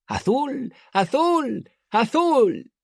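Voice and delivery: put-on very high, childlike wonder; ecstatic cries. A narrator's reading. Elodin crying out at the cloudless sky, ecstatic high cries. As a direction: cry out to the sky.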